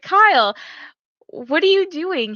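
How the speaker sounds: wife calling to her sleepwalking husband, loud and incredulous; loud exasperated call. Retold or imitated. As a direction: call across the room to him, half laughing.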